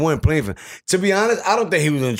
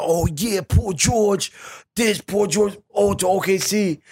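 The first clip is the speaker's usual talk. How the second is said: higher-pitched mock cheering for a trade; mock celebration; 8 semitones higher, slower than usual. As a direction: imitate fans hyped over big news. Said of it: "everybody celebrating".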